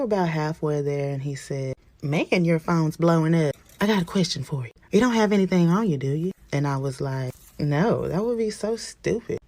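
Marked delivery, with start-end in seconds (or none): 2.0-6.3 raised, loud cop voice; probing officer. Acted. Officer to passenger halfway to jail, probing. 7.6-9.4 high, drawn-out protest; emphatic denial. Acted. Passenger lying to officer about weed, emphatic denial.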